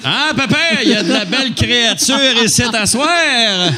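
high, loud hammed-up old man; old-timer voice. Comics mocking old-fashioned talk, hammed-up old man. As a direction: play a randy old grandpa laughing. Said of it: "pour sonner comme un vieillard".

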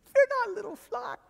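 a tender shepherd comforting his flock. Acted. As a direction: coax it gently, lifting high. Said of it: "he does it so tenderly".

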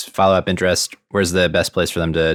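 usual speaking voice

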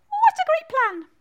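high, loud squealing bat. Acted. Bat cheers the rescue plan, high loud squeal. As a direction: exclaim in a big squeak.

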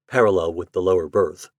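ordinary delivery, nothing marked

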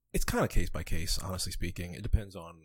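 low, soft and breathy; sexy voice. Real, not acted. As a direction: drop into a low seductive murmur. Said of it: "Why are you trying to get the sexy voice on this?".

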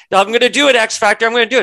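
high, loud mocking imitation of a threat. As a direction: make her threat sound hammed-up and silly. Mocking Mystique's threat to act.

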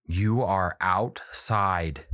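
very slow, word-by-word insistence; frustrated child. Retold or imitated. Frustrated daughter insisting to her dad at a restaurant, imitated. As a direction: spell it out slowly, imitating a fed-up kid. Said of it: "at this point she's frustrated".

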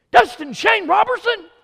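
very high, loud full-name shout; full-name scold. Retold or imitated. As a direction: bellow the whole name like an angry mother. Mama calls his full name.

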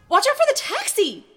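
high, loud warning cry; alarmed shout. A narrator's reading. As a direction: yell a sudden warning at yourself. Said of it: "she admonished herself".

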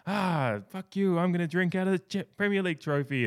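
high, hammed-up angry outburst; losing his mind. Pep loses it at Klopp in the cartoon, mock angry outburst. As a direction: crack and snap back like a sore loser. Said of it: "Pep finally loses his mind and goes".

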